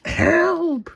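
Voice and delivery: high mock cry for help; high cat voice calling help; yelling help. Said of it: "yelled Pilchard".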